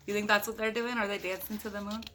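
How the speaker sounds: ordinary delivery, nothing marked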